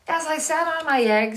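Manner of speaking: high, loud hen; hen's voice. Acted. The hen explaining to the chicks, high. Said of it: "said the hen".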